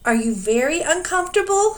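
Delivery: high, loud call over the storm; screamed. A narrator's reading. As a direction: shout above the noise. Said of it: "he screamed above the noise".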